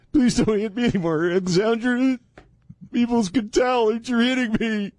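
high, whiny pleading voice; timid pleading man. Acted. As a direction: beg her meekly in a high voice. Acting the meek fiancé.

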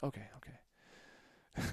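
slow, breathless whisper; a slow, scared little whisper; scared whispered okay. Retold or imitated. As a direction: barely get the words out, frightened. Scared boy agrees to go.

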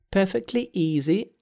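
high, perky monkey king voice; cheeky monkey hero. Acted. Monkey's voice in read story.

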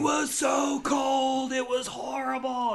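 high, slow, overwrought awards campaign voice; mock dramatic pitch. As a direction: ham up the tragic suffering. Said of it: "the publicity campaign that's like".

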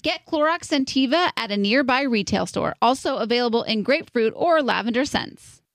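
ad read. Acted. Scripted Clorox ad dialogue.

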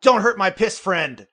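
high, loud scolding yell; scream at him. Acted. Player screaming at the reptilian to spare the pilot, loud yell. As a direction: yell it as an urgent warning. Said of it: "I'm just gonna scream at him".

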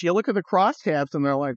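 ordinary talk

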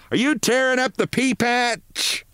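very high-pitched hollered question; high calling voice, acted without real volume; yelling. A narrator's reading. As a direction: holler it from another room. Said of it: "Missus Barrows yelled from the kitchen".

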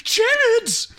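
high shrieking cry of the name; Stark impression. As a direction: screech it out in shock. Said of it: "my pitch perfect Stark impersonation".

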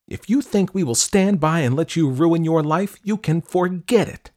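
high, loud angry outburst; angry mother. A narrator's reading. Mother refusing to let daughter ruin her life, angry. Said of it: "Her mother was angry".

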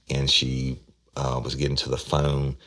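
normal talking voice

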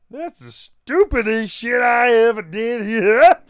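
hammed-up backwater Southern holler; Gomer Pyle. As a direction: stretch it out like an outraged backwoods yokel. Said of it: "doing the Gomer Pyle".

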